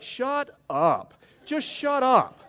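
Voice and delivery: high, loud mock snap; exasperated outburst. Overloaded boss to pestering pastors, comically exasperated. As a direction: erupt in hammed-up exasperation.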